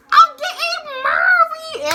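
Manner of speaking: high, loud, drawn-out squeal; thrilled announcement. Retold or imitated. Girl telling friends she's getting married, ecstatic squeal. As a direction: scream it slowly, laughing with excitement.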